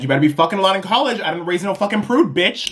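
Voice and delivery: high, fast, brash mom; pushy mom voice. Pretend. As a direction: bark it like a crude mom. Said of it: "the image of a mom being like".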